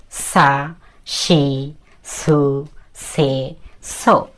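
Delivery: drawn-out syllables, one at a time; measured syllable-by-syllable model reading; reading kana aloud. Shown as a demonstration. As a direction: recite each sound separately, holding the vowel. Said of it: "এভাবে পড়বেন।".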